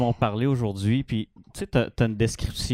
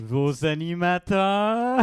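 higher, slow show-host intro; cheesy announcer voice; 7 semitones higher, much slower than usual. Pretend. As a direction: ham it up like a circus presenter. Joins mock podcast intro.

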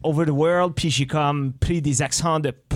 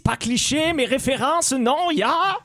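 much higher, hammed-up Austrian accent; 9 semitones higher than usual.

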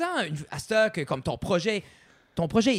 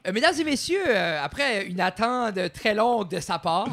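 booming stage introduction; 3 semitones higher than usual. Acted. Formal welcome of the guest.

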